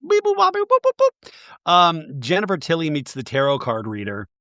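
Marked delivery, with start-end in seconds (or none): 0.1-1.7 bleep it out like a censor; squeaky high beeps covering the words; mock censor beeps. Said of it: "censor bleeps vocalized".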